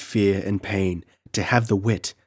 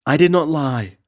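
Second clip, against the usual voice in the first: high, loud tearful protest; sobbing denial; 7 semitones higher, 5 dB louder than usual. Acted. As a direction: plead innocence through tears. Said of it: "sobbed Tina".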